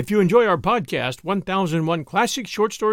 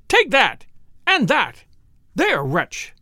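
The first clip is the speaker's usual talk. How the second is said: high, loud exclamations dropping on the last; furious cry; 5 semitones higher, 4 dB louder, slower than usual. A narrator's reading. Furious husband boxing his wife's ears, crying out. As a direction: punch out each short burst angrily. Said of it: "he cried".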